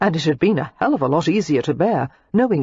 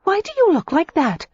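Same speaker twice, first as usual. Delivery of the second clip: put-on higher, heated young woman's voice; Daisy, furious; 13 semitones higher than usual. A narrator's reading. Daisy snaps back at Matthew in fury. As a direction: hurl the accusation back at him.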